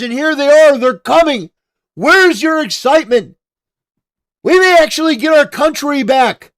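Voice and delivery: loud, high, pumped-up cheerleading; hyped-up rant. Acted. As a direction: whip the crowd into enthusiasm. Hyped rant about deportations.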